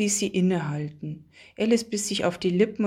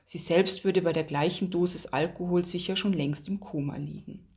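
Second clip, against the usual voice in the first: ordinary delivery, nothing marked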